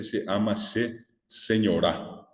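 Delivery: "señora" drawn out slowly as señorÁ, stressed on the last A; Guarani final stress. Shown as a demonstration. Teacher quotes a known song's first line, "señora" stressed on the end. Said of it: "el acento está en la A".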